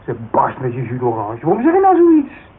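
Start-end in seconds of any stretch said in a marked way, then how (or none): 1.4-2.5 much higher and louder, outraged; hurt pride bursting out; offended shout. Acted. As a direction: fling the question at her. Insulted man in morning quarrel.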